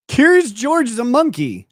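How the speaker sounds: incredulous. Real, not acted. Disbelieving protest at a claim.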